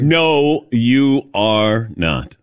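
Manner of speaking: slow, word-by-word denial; firm contradiction. Real, not acted. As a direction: spell out the denial slowly. Firmly contradicting Todd's flirting claim.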